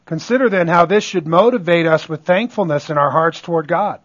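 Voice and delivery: ordinary talk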